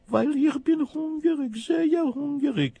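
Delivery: very high, slow kitten voice; high plaintive kitten. Acted. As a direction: plead like a hungry kitten. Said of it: "macht das Kätzele".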